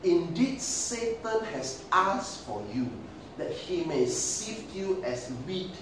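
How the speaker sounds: slow, solemn scripture voice; Jesus. Acted. Jesus telling Simon he has prayed, slow solemn address. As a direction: draw each phrase out slowly, with weight. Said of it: "Jesus said".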